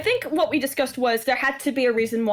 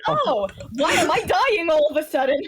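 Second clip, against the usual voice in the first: confused character voice; 6 semitones higher than usual. Retold or imitated. Quoting own character's confusion.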